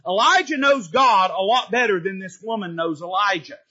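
ordinary talk

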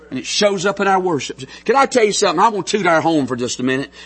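ordinary delivery

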